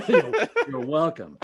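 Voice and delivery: laughing welcome. Real, not acted. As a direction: laugh while saying you're welcome. Laughing at thanks.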